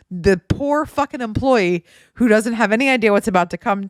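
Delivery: ordinary talk, nothing marked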